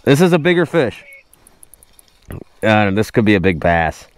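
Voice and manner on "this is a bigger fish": usual speaking voice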